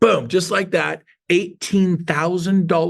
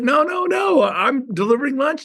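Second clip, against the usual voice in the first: high eager denial; 10 semitones higher than usual. Retold or imitated. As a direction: wave it off in a high voice.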